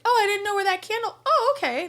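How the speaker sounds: high, quick feigned cluelessness; mock realization. Waitress imitating an odd customer's feigned discovery. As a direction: rush it out high, as if only now catching on. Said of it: "Like, as if, like".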